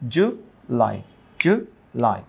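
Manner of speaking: জুলাই in two separate beats, "ju, lai"; split into syllables. Shown as a demonstration. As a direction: break জুলাই after the first syllable. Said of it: "কয়টা syllable".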